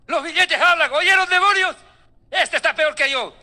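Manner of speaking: high, loud mock-amazed Satan. Preacher voicing Satan amazed at the preacher, high and loud. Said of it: "El mismo Satanás dice".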